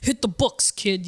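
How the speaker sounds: put-on dad's lecture in a light New York accent; a hint of a Hell's Kitchen New York dad. Father warning his son to study, light New York accent. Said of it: "This is an amazing New York accent".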